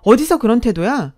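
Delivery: angry scolding. Acted. Mother-in-law scolding her son's wife over a guest, angry. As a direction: scold the daughter-in-law in anger. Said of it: "막 화를 내는 겁니다".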